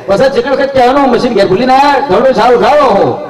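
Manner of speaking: high, rushed mock MC; village announcer. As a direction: call out insistently from the stage. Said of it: "ફાડી નાખે એવી".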